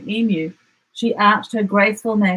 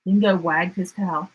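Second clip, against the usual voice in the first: ordinary delivery, nothing marked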